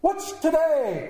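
high, drawn-out holler; calling down. A narrator's reading. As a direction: call down from a window to a boy. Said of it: "cried Scrooge downward to a boy".

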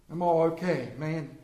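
ordinary delivery

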